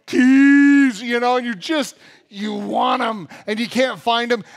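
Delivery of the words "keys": loud mock scream of one word; furious yell at the lost keys; rage-filled scream. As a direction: scream it from your soul, hammed-up. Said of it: "a rage-filled scream of".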